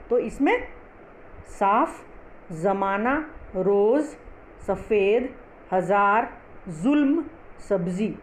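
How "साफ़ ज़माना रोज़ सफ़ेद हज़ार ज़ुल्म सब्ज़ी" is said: साफ, सफ़ेद with f, जमाना, रोज़, हज़ार, जुल्म, सब्ज़ी with z; correct pronunciation. Shown as a demonstration. Teacher models the list, साफ to सब्ज़ी with nukta sounds. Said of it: "ऐसे हम पढ़ेंगे इसको".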